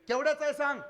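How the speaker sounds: put-on high, loud old woman; old mother's voice. Old mother demanding the true saree price. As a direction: press him like a suspicious old mother.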